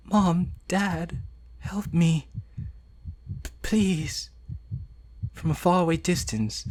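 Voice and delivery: put-on soft, quiet, tearful girl; dying girl calling for her parents; fading Yuri. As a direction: whisper it as life fades. Yuri's dying monologue.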